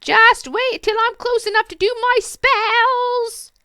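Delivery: high, slow cackling witch. Acted. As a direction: call out like a slow old witch. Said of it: "the witch called out after them".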